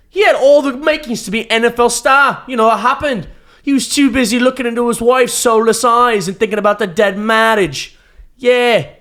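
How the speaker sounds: loud, high-pitched English pundit rant; Gary Neville. Acted. Host closing the podcast with an impression, loud high English punditry. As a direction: shout it in a hammed-up British accent. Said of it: "my Gary Neville impression".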